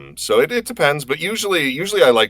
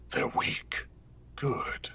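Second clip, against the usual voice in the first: soft unvoiced whisper, last word lifted higher; Omen voice; 7 semitones higher, 11 dB quieter, much slower than usual. Recalling a game line for the streamer, hushed whispered menace. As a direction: hiss it quietly with a pleased lift. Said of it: "goes a little higher".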